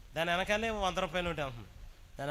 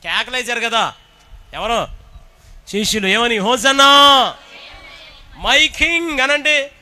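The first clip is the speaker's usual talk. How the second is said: much higher and louder, shouted acclaim; preaching at full shout; 4 semitones higher, 15 dB louder, slower than usual. Real, not acted. Preacher shouting praise to the king at the climax. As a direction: roar it like a crowd hailing a king.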